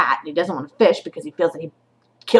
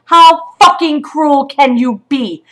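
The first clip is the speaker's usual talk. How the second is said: slow and louder, each word hammered; slow furious repeat; 6 semitones higher, 7 dB louder, slower than usual. Real, not acted. Mother repeats her accusation to the shelter, slow and hammering.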